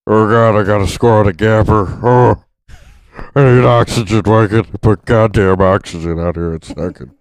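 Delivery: loud, winded hammed-up fat ballplayer; overweight player impression. Heavy player gasping through a game, loud and winded. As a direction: puff and gasp as if out of breath. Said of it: "three hundred and eighty pounds".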